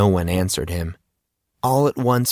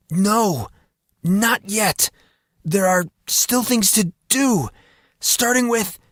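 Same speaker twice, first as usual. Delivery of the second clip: much higher, strained defiance; straining through pain; 12 semitones higher than usual. Acted. Monson resisting the fire on his skin, strained and much higher. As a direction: force the words out against the burning.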